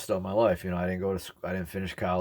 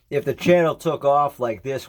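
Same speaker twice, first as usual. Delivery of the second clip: louder voice; 6 semitones higher, 7 dB louder than usual. Shown as a demonstration. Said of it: "where I could talk like this".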